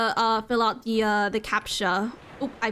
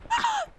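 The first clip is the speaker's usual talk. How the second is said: high gasp. Real, not acted. Thrilled on the lighthouse.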